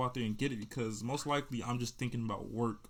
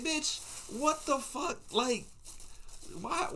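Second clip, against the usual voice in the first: much higher, exasperated "bitch, what the fuck"; high outraged disbelief; 13 semitones higher, slower than usual. Retold or imitated. Telling how he answered the girl, high indignant shock. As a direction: throw it back at her in disbelief. Reacting to her feminine claim.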